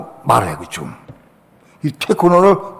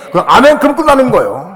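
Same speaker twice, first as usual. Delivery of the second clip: excited amen; 7 semitones higher, 6 dB louder than usual. Real, not acted. Preacher exclaiming amen.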